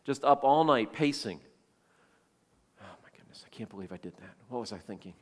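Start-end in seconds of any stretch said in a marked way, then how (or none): 2.8-5.2 very soft, low muttering to himself; barely voiced, as if thinking aloud; hushed self-reproach. King up all night pacing over his decree, hushed self-reproach.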